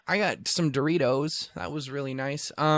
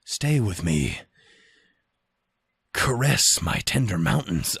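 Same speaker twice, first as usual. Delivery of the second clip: low, slow seductive plea; mock-sultry voice of a possessive place; seductive Montana; 5 semitones lower, slower than usual. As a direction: draw it out like a clingy lover. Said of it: "Montana was like".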